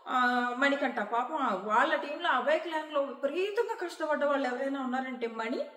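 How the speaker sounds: usual speaking voice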